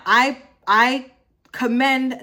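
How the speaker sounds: ordinary delivery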